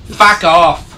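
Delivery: mock British snarl. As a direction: bark it like a cheeky Brit. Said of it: "echoing the English insult".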